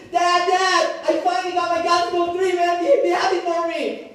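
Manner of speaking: put-on high, loud, fast boyish bragging; begging dad to share the thrill, loud and high; excited bragging. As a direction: shout it out like a kid showing off a new toy. Bragging to dad about phone.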